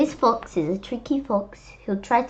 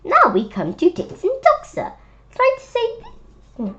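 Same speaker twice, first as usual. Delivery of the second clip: high, louder, coaxing fox; eager fox voice; 13 semitones higher, 4 dB louder, faster than usual. Acted. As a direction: play the sly fox tempting Knox. Fox introducing next trick.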